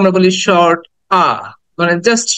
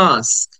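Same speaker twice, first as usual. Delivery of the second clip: "Ask" with the vowel held long; "ask" as "aaask"; drawn-out "aa"; 8 semitones lower, 5 dB quieter, much slower than usual. Shown as a demonstration. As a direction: stretch the "aa" in "ask" before the final "sk". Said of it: "আটাকে টেনে বলতে হবে".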